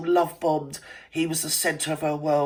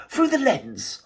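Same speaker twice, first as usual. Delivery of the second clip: "through the lens" lifted higher, as if in quote marks; quoted buzzword; 8 semitones higher, slower than usual. Shown as a demonstration. Vlogger mocking a buzzword, pitched up. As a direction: sing out "through the lens" with a high lilt. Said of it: "to coin the operative word".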